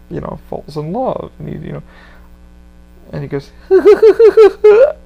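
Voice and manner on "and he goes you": high, loud, slow cackle; imitating the Joker's slow maniac laugh; Mark Hamill Joker laugh. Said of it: "that's my Mark Hamill".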